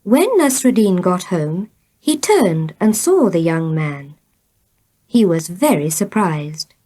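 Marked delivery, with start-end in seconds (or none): none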